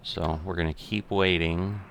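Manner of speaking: normal talking voice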